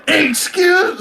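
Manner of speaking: high-pitched mock outburst; imitated catchphrase. Podcaster imitating her excuse me, high mock outburst. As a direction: cry it out high, cut short. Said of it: "she's all like".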